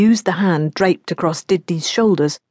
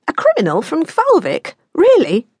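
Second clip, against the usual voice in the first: surprised; 10 semitones higher than usual. Acted. Daughter surprised at criminal.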